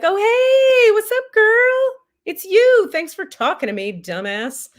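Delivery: high, bright-faced, slow and loud cheery greeting; God's voice. Retold or imitated. Teller voices God greeting her cheerfully, high and loud. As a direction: play God delighted to hear from an angry friend. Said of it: "God's like".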